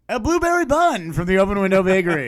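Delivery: high, loud game-show reveal; mock-triumphant announcer; radio prize announcer. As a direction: proclaim the prize like a hammed-up DJ.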